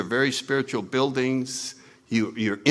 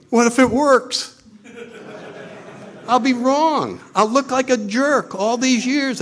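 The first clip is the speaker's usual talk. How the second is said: high, alarmed comic outburst; ego's mock fear; 11 semitones higher than usual. The ego dreading that praying works, mock alarm. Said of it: "the greatest fear the ego has".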